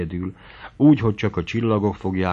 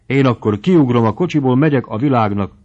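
louder, headstrong defiance; wilful outburst; 5 semitones higher, 7 dB louder than usual. A narrator's reading. Mari threatens Feri she'll jump from the carriage, wilful outburst. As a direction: burst out stubbornly, threatening to jump. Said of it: "fakadt ki akaratosan".

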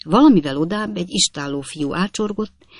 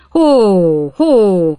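loud, higher whoa cry; shouting from behind; 4 semitones higher, 9 dB louder than usual. A narrator's reading. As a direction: yell at the horse from the back seat. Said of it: "kiabált hátulról Tupman úr és Snodgrass úr is". (hangosan, magasabban kiabálva)

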